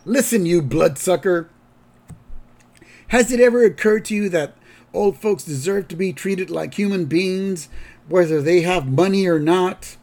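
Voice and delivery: mock outrage, pressing each point home; indignant Grandpa. Grandpa scolding the nursing home director, indignant. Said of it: "To which Grandpa says".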